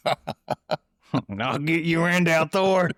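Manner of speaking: high, very slow laughing Southern drawl; Southern accent. Retold or imitated. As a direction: growl it like a cartoon Southern villain. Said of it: "have Southern accents".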